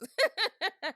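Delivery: high, loud burst of laughter. Real, not acted. Laughing at her own accent imitation, loud laugh.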